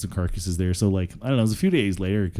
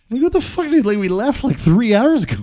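much higher, loud incredulous outburst; exasperated disbelief; 7 dB louder than usual. Retold or imitated. To the late buddy on the phone, incredulous and exasperated. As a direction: ask it like you can't believe he's serious.

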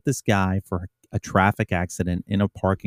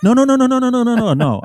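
high, loud rapid string of no's; panicked no; 13 semitones higher, 4 dB louder than usual. Retold or imitated. Officer frantically pleading with the judge, high panicked no's. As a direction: blurt it out to stop the judge.

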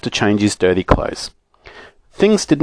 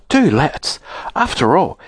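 higher-pitched coaxing; pleading; 6 semitones higher than usual. A narrator's reading. Bessie persuading Joe to visit the land, pleading. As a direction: urge with mild pleading. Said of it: "cried Bessie".

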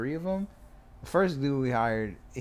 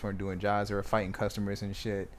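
ordinary delivery

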